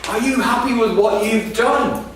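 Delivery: jab the question like a pointed finger; angry woman's demand. Retold or imitated. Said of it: "And she says".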